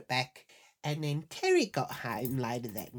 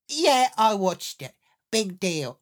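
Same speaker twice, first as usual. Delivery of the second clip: loud, higher-pitched so-what retort; defiant admission; 5 semitones higher, 8 dB louder than usual. Retold or imitated. Wife admitting it to her husband, loud and defiant. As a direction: own up loudly, brazening it out.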